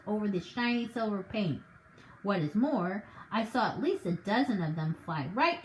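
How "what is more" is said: ordinary delivery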